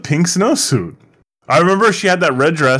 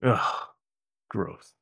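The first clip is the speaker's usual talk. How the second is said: disgusted low groan; annoyed ugh; 6 semitones lower, 9 dB quieter than usual. Real, not acted. Groans at review.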